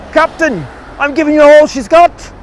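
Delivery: high, loud Scotty impression in a Scottish accent. Guide imitating Scotty answering Captain Kirk in a Scottish accent. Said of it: "'cause he had a Scottish accent".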